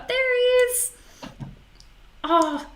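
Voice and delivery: high thrilled squeal at the bandicoot; real delight, voice jumps up; excited. Real, not acted. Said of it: "we always get super excited".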